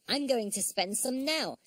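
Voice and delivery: high, eager little hero; excited childlike character voice; Noddy. A narrator's reading. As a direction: announce it gleefully, pitched right up.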